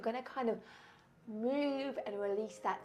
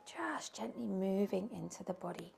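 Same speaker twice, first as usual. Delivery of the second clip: guided meditation; 2 semitones lower than usual. Acted. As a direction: lull the class gently as they rock. Leads a soothing rocking meditation.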